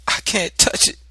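high, loud frightened whimper. A narrator's reading. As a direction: whimper it in a child's terror. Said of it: "I whimpered".

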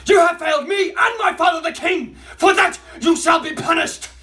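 furious shouting at full force; enraged boss roar. Acted. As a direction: roar it down at the failed soldier. Boss berates failed underling.

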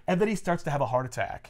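normal talking voice

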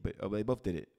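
ordinary talk, nothing marked

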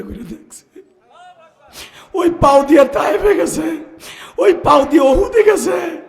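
loud, high fervent proclamation; impassioned preaching. Real, not acted. Preacher recalling the Prophet's journeys, impassioned. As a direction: ring each phrase out to the crowd.

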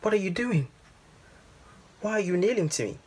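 high-pitched, bewildered plea; distressed questioning. A narrator's reading. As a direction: question your father in high-pitched dismay. Distressed boy questions kneeling father.

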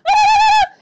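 high, loud screaming cry; shriek. Retold or imitated. Vlogger acting the witch's scream, loud high shriek. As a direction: let out a piercing scream. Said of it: "she goes".

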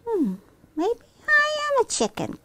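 high, wondering little voice; tentative child. Acted. Claire deciding she may be a chicken, tentative. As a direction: try the idea out in a high child's voice.

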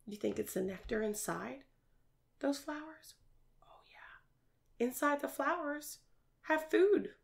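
put-on hushed whisper to draw kids in; playful teacher whisper; secret whisper.

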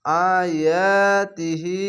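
আয়াতিহি with each long vowel held one alif, slow; one alif stretch. Shown as a demonstration. Teacher demonstrates the one-alif stretch on আয়াতিহি. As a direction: draw আয়াতিহি out evenly. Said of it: "এক এক আলিফ টেনে টেনে পড়বেন".

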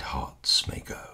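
breathy whisper to close the line. Acted. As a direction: drop to a hushed whisper. Whispered line in poem recital.

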